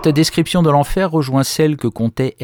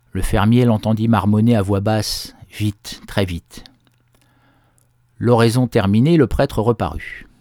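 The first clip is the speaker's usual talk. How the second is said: ordinary delivery, nothing marked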